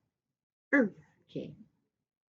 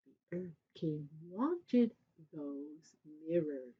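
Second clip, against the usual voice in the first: usual speaking voice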